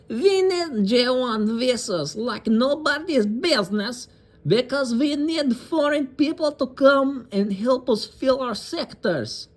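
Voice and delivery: high, slow, hammed-up Russian accent; mock Russian accent. Quoting the congresswoman's remarks in a slow, high mock Russian accent. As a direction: ham up a Russian accent to make her sound crazy. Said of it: "I don't know why I'm doing a Russian accent".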